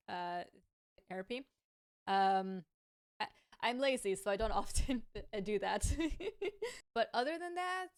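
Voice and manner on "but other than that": laughing it off. Real, not acted. Streamer laughing at own laziness, amused. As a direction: chuckle through the words, a bit embarrassed.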